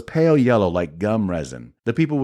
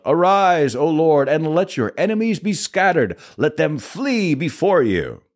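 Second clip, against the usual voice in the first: raised high, ringing call to the Lord; proclaiming shout; 7 semitones higher than usual. A narrator's reading. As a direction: cry it out like a solemn proclamation. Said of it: "Moses would shout".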